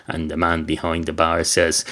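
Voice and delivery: ordinary delivery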